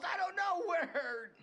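slow, half laughing, half sobbing; broken, hysterical pleading; laughing through pain. Acted. Suspect breaking down in pain.

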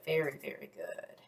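soft whispered encouragement. Real, not acted. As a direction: hush the praise to a whisper. Teacher praising softly.

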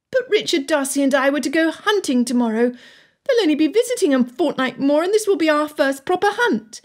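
put-on high-pitched girl's protest; upset daughter, pitched well up; pleading daughter. A narrator's reading. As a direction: plead with your father in a high voice.

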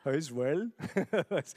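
words breaking into a laugh; amused at yourself, laughing through it; tickled chuckle on the words. Real, not acted. Laughs at own accent attempt.

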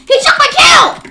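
high, loud complaint; outraged yell. Real, not acted. Player complaining of a stolen kill, high outraged yell.

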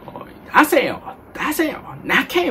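mock angry demand, pitched high; Shinobu Sakagami. Coach demanding tears from an unemotional reader, mock angry. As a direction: bark it like a scolding acting coach, hammed up. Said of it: "坂上忍さんに怒られそうですね。".

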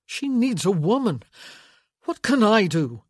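loud, high, desperate protest. A narrator's reading. Son argues his way out with mother, desperately. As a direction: protest urgently that you are no use. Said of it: "desperately trying to redirect the conversation".

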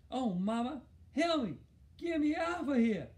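high, loud muffled pleading; mouth stuck. A narrator's reading. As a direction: wail for Mama with your lips stuck. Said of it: "like he had something in his mouth".

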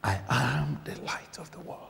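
breathy reverent whisper; preacher whispers the scripture to the congregation. Real, not acted. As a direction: whisper the verse to the room. Preacher repeats scripture in hush.

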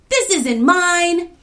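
high, loud, drawn-out protest; alarmed shout. A narrator's reading. Kofi disowning the key, alarmed shout. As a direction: deny it at full voice. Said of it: "shouted Kofi".

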